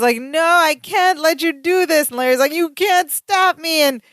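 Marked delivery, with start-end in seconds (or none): 0.2-2.1 higher-pitched, lightly acted plea; a touch of desperate refusal; mild protest. Retelling Balky stopping Larry with the gun, mild protest. 2.5-3.8 high, slow defiant retort; drawn-out, lightly acted defiance; mock defiance. Retelling Larry refusing to be stopped, mock defiance.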